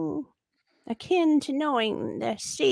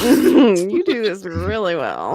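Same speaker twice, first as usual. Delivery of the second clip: laughing; 8 dB louder than usual. Real, not acted. Echoing while laughing.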